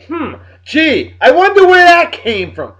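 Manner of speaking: high, loud mock-innocent wondering; sarcastic musing. Reacting to coworker Steph's Patriots jab, sarcastic mock wondering. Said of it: "I'm like".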